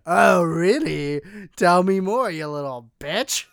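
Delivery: slow, loud mock-interested sneer; drawn-out taunting retort; sarcastic taunt. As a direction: draw it out, goading the other driver. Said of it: "And I was just like".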